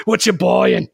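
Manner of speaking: loud Australian merchant quote; hammed-up Aussie shopkeeper; goofy Australian accent. Repeating the game quote for a laugh, goofy Australian accent. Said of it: "that goofy accent".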